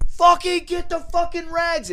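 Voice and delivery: high, slow furious yelling at the helper; frantic yell. Retold or imitated. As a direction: scream it in a panic. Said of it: "and I'm like".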